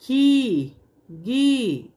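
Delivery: खी, गी said with the vowel held long; long ii sound. Shown as a demonstration. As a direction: stretch the ई in खी, गी. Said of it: "बड़ी की मात्रा लगाते हैं".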